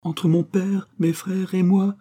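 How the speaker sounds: high, emotional pleading; acted tremulous appeal, lightly voiced; trembling voice. A narrator's reading. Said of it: "s'écria-t-elle d'une voix tremblante".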